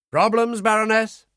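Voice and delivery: crisp question from a colonel; army colonel. A narrator's reading. Colonel checking on the nurse, brisk.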